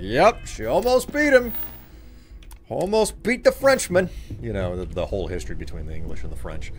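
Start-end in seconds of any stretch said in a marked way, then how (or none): 0.0-4.1 mock meme voice. Meme voice quoted.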